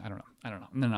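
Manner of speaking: ordinary talk, nothing marked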